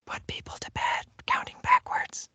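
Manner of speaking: soft breathy ASMR whisper into the mic. Shown as a demonstration. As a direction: whisper it close to the microphone. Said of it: "Just whisper".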